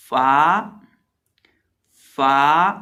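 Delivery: फा said loudly and crisply; letter demo. Shown as a demonstration. Teacher drilling the class, loud फा. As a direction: pop फा out loud. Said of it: "letter drill".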